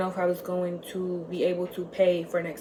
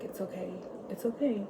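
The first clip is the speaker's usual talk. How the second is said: soft, hushed reassurance; calm her down gently; soothing friend; 10 dB quieter than usual. Retold or imitated. Friend wiping her tears, softly reassuring.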